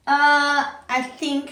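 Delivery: loud, high, drawn-out "uh"; hesitant reply. A narrator's reading. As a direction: stall before politely declining. Koala's hesitant reply to the shout.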